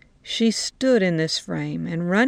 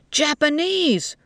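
shouted; 9 semitones higher, slower than usual. A narrator's reading. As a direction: yell the word right at her. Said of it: "one of them shouted at her".

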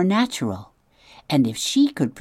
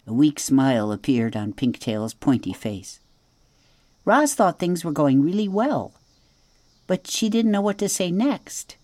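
plain reading voice

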